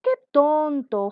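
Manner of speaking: high, loud, drawn-out mockery; demonstrated exclamation. Shown as a demonstration. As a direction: exclaim it slowly and loudly. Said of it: "dentro está el signo de exclamación". (exclamación burlona, fuerte y alargada)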